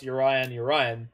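Urian said yuh-RYE-un, like Orion with a Y; own pronunciation. Shown as a demonstration. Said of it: "the reason I pronounce Urian".

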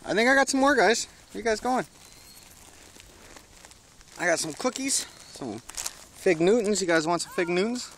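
high, sing-song offer of cookies; coaxing pet talk. Real, not acted. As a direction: tempt the birds with a sweet high voice.

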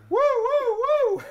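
high, loud woo-woo siren sound; siren wail. Acted. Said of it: "Mister Siren!".

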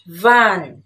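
van, loud and clear like a model word; teacher modelling van, stressed and clear. Shown as a demonstration. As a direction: say van slowly and clearly for repeating. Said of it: "trong tiếng Anh sẽ đọc là".

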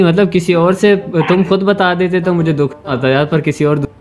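ordinary talk, nothing marked

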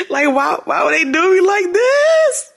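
high whine climbing to the end; high-pitched comic lament; mock-crying plea. Real, not acted. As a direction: wail the question upward, about to laugh. Wailing over the article.

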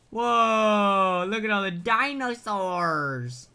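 high, stretched-out cry of wonder; awed excitement. Real, not acted. Gamer sees all the dinosaurs, awed exclamation. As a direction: draw it out in amazement.

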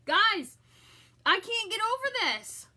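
high, loud and thrilled; overwhelmed with delight, pitched high; excited raving. Real, not acted. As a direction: gush loudly to your viewers. Raving over a scent she loves.

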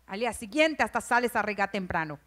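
ordinary talk, nothing marked

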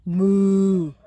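loud mooing cow. Acted. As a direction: moo it out like a cow. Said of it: "said the spotted cow".

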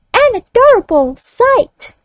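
put-on high child voice echoing back. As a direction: echo it like a young pupil. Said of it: "the child reads it back".

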